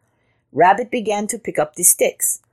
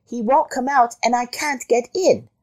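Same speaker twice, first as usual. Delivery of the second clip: high-pitched rabbit voice; complaining rabbit; 7 semitones higher than usual. A narrator's reading. Rabbit telling Jackal of the intruder, high complaint.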